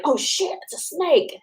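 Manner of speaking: high startled yelp; startled men. Retold or imitated. Mocking startled men at a reptile show. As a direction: squeak it like a startled man. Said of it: "then they'd be like".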